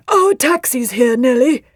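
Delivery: slow, loud call in character. A narrator's reading. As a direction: call it across the hall, stretched out. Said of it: "called Leafmould".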